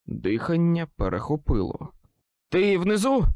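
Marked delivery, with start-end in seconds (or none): 2.5-3.2 high, loud anxious call. A narrator's reading. Wizard calls down to Susan below, loud anxious. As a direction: call down the stairs, tense.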